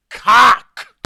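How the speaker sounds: loud, high shouted Cock with a rise; loud yell. Pretend. Streamer showing how easy the word is, loud yell. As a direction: yell it like it's obvious. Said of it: "That one's easy".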